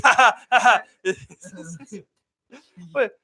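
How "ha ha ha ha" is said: high, forced "ha ha" laugh; exaggerated taunting laughter; loud mock laugh. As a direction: laugh pointedly in someone's face. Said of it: "you go to his face".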